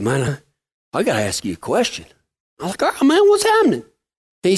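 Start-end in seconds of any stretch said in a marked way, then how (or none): 0.0-2.0 low, much softer, defeated; quiet, low resigned answer; dejected. Retold or imitated. Worried friend on the phone. 2.8-3.8 higher, fast, cheery question; quick bright higher greeting; upbeat. Retold or imitated. Upbeat reply to friend's call.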